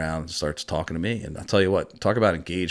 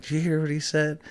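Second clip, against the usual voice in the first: higher, put-on laid-back [name] voice; [name] impression; 10 semitones higher than usual. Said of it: "He's like".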